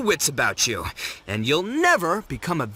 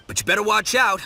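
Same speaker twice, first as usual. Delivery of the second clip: ordinary delivery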